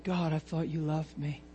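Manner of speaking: low, hushed mock-hurt prayer; quietly wounded, hamming up self-pity; pitiful complaint. Stuck driver moaning to God in traffic, pitiful mock complaint. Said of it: "Isn't that a lame, pitiful way of thinking".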